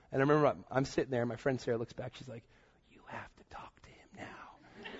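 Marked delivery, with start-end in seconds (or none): 2.9-5.0 slow, pointed, imitated whisper; urgent whisper. Friend Sarah turning back to prod him, slow pointed whisper. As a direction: whisper it like a nudge, each word drawn out.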